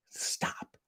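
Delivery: barely voiced mock plea; hushed, whispered appeal. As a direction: whisper it to an absent celebrity. Mock plea to Mister Grammer.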